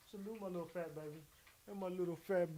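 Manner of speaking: doting owner cooing at his puppy. Real, not acted. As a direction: coo to the tiny dog.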